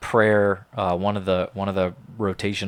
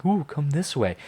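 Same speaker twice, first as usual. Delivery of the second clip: higher, lightly voiced tempting demon; luring demon; 6 semitones higher than usual. Demon coaxing someone astray, lightly mock-luring. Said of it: "demon is ... around you and saying".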